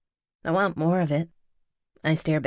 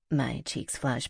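plain reading voice

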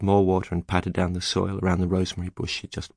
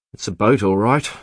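firm, louder declaration; declaring; 7 dB louder than usual. A narrator's reading. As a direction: declare it loudly. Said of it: "Tom declared".

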